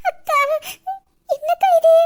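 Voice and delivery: loud surprised exclaim; shocked cry. Acted. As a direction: burst out in shock. Shocked discovery.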